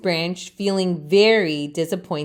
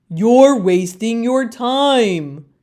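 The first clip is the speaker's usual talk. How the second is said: slow, louder chorus of leaves; know-it-all leaves; 2 semitones higher, 4 dB louder, slower than usual. Acted. Other leaves scold Leaf, slow and loud. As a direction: lecture it slowly like smug elders. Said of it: "the other leaves say".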